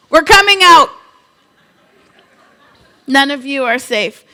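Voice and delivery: bold rallying shout to the staff; loud, half-laughing warning; loud defiant declaration. Retold or imitated. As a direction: declare it like a battle cry.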